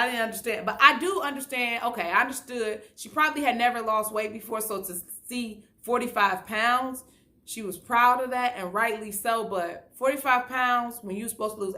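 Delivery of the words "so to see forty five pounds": normal talking voice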